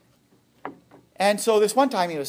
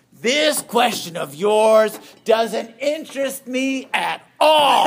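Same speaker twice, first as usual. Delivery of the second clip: slow, higher, pointed dismissal; dry put-down; 8 semitones higher, 8 dB louder, much slower than usual. Retold or imitated. Father Giussani brushing off the young priest's question, slow and pointed. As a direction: let the refusal land slowly, almost amused. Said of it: "He says".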